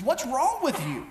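a hint of a mothering wife; light mock-mom nag; imitating a scolding wife. Wife in the kitchen calling to him on the couch, mock mothering. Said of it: "she kinda mamaed me there".

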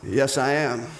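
lightly voiced, low self-satisfied reply; smug Peter. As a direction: play mildly pleased with yourself, pitched low. Acts Peter proud of new name.

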